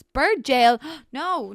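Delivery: loud, incredulous question; loud burst of disbelief; startled outburst. Real, not acted. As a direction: blurt it out in surprise. Startled at what she finds in build.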